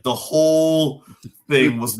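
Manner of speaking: usual speaking voice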